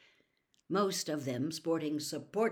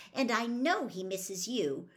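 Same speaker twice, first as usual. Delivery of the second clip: impatient; 5 semitones higher than usual. A narrator's reading. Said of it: "Hermione said impatiently".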